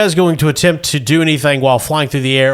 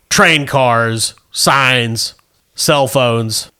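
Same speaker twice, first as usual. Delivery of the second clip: ordinary delivery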